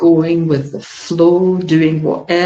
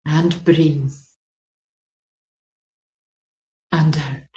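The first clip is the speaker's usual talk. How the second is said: guided meditation. Acted. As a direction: soothe them through the hand bathing.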